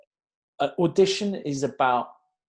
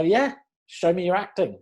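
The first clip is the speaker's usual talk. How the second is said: acted high, keen encouragement; eager go-ahead; 7 semitones higher, 4 dB louder than usual. Auditioner to an actor asking to show acting, eager. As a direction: give an imagined actor an emphatic yes.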